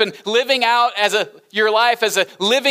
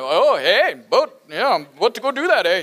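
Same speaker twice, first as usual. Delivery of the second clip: light mock Canadian accent, eh; a playful hint of a Canadian accent; 2 semitones lower than usual. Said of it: "I'm like a linguistic chameleon".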